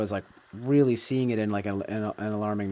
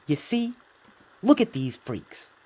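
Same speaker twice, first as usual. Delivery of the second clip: high You see, then slow scornful Look at these freaks; Candace Owens impression; 7 semitones higher, slower than usual. Vlogger imitating a pundit pointing at freaks, high then slow scornful. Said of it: "Candace Owens is like".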